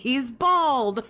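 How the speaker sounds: high, stretched-out jeer; mocking shout. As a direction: crow it as a taunting catchphrase. Last of the shouted catchphrases.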